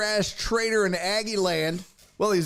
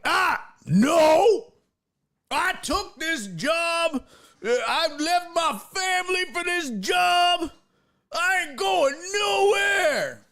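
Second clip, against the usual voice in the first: mock angry coach; 10 semitones higher, slower than usual. Retelling the coach snapping at a reporter, mock angry yell. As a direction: bellow it like an insulted coach. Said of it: "and he was like".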